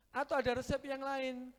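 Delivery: normal talking voice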